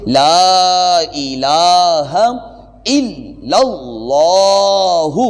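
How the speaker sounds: لا الہ الا اللہ slowly intoned with lengthened لام; full recitation. Shown as a demonstration. Teacher recites the complete Kalima for listeners. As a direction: chant the whole line slowly and melodically. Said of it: "یہ مکمل اب آپ سماعت فرمائیں گے".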